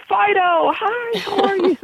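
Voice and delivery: very high squeaky voice for a dog; quadruple octave escalation. Shown as a demonstration. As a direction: talk to the dog like a baby, way up high. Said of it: "that high-pitched voice I call the quadruple octave escalation".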